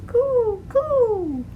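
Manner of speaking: high cooing pigeon sounds. Acted. Pigeon coos in story.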